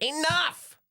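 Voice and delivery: sharp high shout to stop the crowd; commanding shout. Acted. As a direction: bark it out to halt them. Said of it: "he's like".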